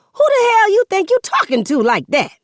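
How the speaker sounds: a mother up in her son's face, yelling; furious mother. Acted. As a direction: get in his face, furious.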